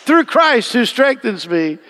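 preacher crying out loud to the Lord in prayer; fervent cry. Real, not acted. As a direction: cry it out to the Lord at full force. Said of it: "just say".

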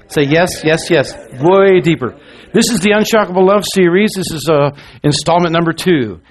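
rally the congregation, call-and-response energy; full-force preaching. Acted. Opening call-and-response, revving up church.

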